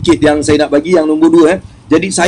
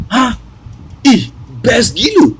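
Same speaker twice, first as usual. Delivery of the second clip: higher-pitched gasp of delighted disbelief; retold amazed thrill; 6 semitones higher, slower than usual. Speaker retelling his reaction to her thanks, amazed thrill. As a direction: stretch it out, thrilled at a new feeling. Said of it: "benda tu yang buat aku macam".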